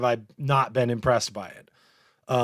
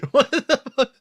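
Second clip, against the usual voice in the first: high, breaking into laughter; shocked laugh-cry of disbelief; real giggle-squeal of disbelief; 13 semitones higher, 4 dB louder than usual. Real, not acted. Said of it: "cry laughing".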